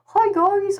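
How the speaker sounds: high, shy guy greeting; Ollie. Acted. Streamer showing how Ollie talks, shy and high. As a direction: put on a timid, high-pitched guy voice. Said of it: "I usually view Ollie to talk like".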